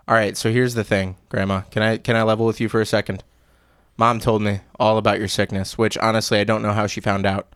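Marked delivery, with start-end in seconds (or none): none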